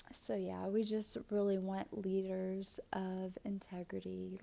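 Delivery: ordinary delivery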